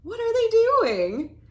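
put-on high, loud, wondering child's question; curious question. Character in the book asking curiously, high and loud. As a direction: ask it full of wide-eyed wonder.

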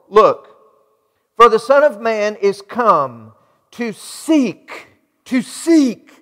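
ordinary delivery

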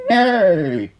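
high, loud horse whinny; loud neigh. A narrator's reading. Horse greeting hen Henry with a loud neigh.

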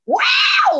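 very high, loud panther yowl; imitated panther scream. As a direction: screech like a wild cat close by.

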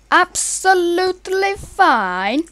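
hammed-up Italian accent for a laugh. Shown as a demonstration. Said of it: "did that in an Italian accent".

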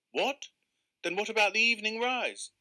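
can't believe his ears; alarmed disbelief. Acted. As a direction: angler alarmed, questioning the keeper. Angler alarmed at no afternoon.

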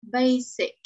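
Basic with a clear S, BAY-sick; model pronunciation. Shown as a demonstration. Teacher models word twice for the class. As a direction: say Basic crisply with an S. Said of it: "Lớp mình đọc cho cô hai lần".

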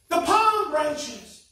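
slow, high, loud demand; shouted. A narrator's reading. Donkey shouting at the market crowd for palm branches. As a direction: yell out for the missing palms. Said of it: "he shouted".

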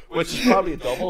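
real laughter, loud and high; helpless high-pitched laughing talk. Real, not acted. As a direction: crack up while adding the punchline. Cracking up at own story.

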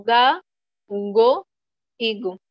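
"ga", "go", "gu" con g dura; sílabas sueltas "ga", "go", "gu", separadas por pausas; pronunciados tal cual. Shown as a demonstration. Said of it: "se pronuncian tal cual". (g dura española en "ga", "go", "gu")

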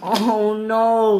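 high, loud, drawn-out "oh no"; dismayed wail. Acted. Stephen watching the fall, high dismayed wail. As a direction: wail it in acted horror.

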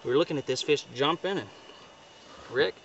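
ordinary talk

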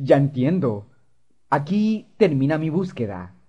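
usual speaking voice

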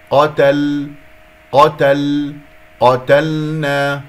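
قتلنا with a ringing, briefly held l; deliberate repeated model of قتلنا; correct clear lam. Shown as a demonstration. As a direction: punch قتل, letting the l sound before the n. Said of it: "يجري فيها الصوت فترة".

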